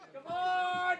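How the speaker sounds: loud cheering yell; shouted cheer. Real, not acted. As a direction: yell them on. Cheering on players.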